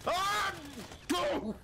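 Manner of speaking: sharp high shriek then shouted go; high excited yell. Real, not acted. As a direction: yelp and push forward. Sudden attack in game.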